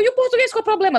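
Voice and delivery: high, disbelieving question to the daughter; incredulous mom. Retold or imitated. Mother asks daughter why she refuses, incredulous and high. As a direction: sound amazed she'd refuse. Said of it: "Aí eu".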